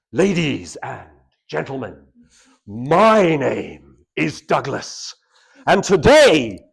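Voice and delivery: slow, loud, booming over-the-top announcer; hammed-up booming introduction; overblown grand opening. Shown as a demonstration. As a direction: blast it out like a grand showman. Overblown opening demo, too intense.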